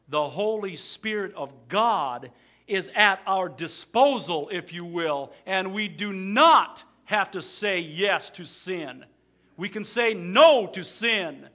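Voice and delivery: loud, slow, rising sermon voice; full-force preaching. Acted. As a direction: preach it out, building.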